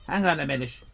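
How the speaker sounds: ordinary talk, nothing marked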